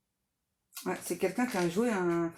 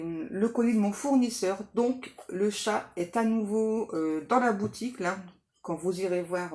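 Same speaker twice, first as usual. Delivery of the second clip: ordinary talk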